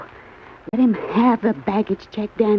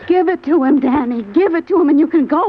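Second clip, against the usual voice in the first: higher, louder pleading; louder urgent plea to the tied man; 4 semitones higher, 4 dB louder than usual. Acted. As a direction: push him hard to give it up. Begging captive to hand it over.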